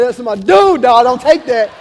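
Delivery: loud, fired-up proud dad. Retold or imitated. Proud dad to his fighting newborn, loud and boastful. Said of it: "One, I'm a proud dad".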